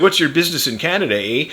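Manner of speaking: Canadian border guard. Retold or imitated. Border guard questioning dad at the crossing, lightly mocked Canadian official. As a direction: question them quickly like an official. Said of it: "the guy at the Canadian border".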